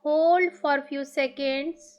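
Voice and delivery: very slow, drawn-out breathing instructions; guided meditation. Acted. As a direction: stretch each cue out slowly with long pauses.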